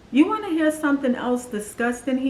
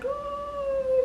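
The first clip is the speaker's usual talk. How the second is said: imitating crying; 6 dB quieter than usual. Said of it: "you know, I'm crying".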